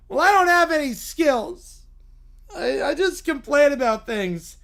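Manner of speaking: high, loud self-pitying whine; whiny Little Bear. Acted. Little bear hearing bears have skills, loud whine. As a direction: wail it like a hard-done-by cub.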